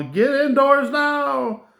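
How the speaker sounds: high, drawn-out mock yell; retold yell. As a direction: call it down to people below, stretched and high-pitched. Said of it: "I'm yelling down to the people".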